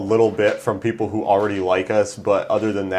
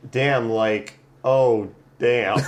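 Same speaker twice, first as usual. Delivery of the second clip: usual speaking voice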